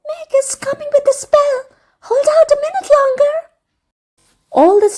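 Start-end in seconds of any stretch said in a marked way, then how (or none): 0.1-3.3 much higher, quick, urgent, voiced not whispered; a breathless little messenger rushing the news; excited whisper. A narrator's reading. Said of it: "he whispered excitedly".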